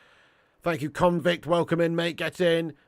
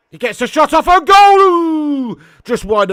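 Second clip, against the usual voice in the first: much higher, much louder shot call into a groan; excited shout; 13 semitones higher, 17 dB louder, slower than usual. Real, not acted. Streamer watching Nketiah's shot go wide, excited shout. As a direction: roar it as the ball flies past the post. Shot on goal, live reaction.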